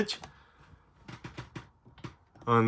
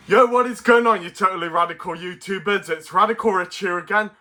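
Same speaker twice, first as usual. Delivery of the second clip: put-on extreme hyped kids ad; 6 semitones higher, faster than usual. Hyped channel intro.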